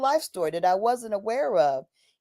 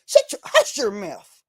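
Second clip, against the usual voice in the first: put-on a light take on an old Virginia "hush your mouth"; Virginia dialect; 4 semitones higher than usual. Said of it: "in Virginia say".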